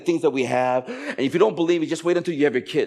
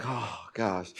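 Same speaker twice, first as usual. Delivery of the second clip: mock exasperation; 5 semitones lower, 10 dB quieter than usual. Exasperated wife in retold scene.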